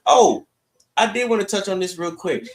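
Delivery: ordinary talk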